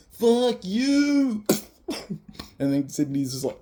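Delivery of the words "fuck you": high, loud burst of "fuck you", tossed off as a retold insult; a high, loud mock yell thrown at the other guy; mock shout. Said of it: "And then he's like".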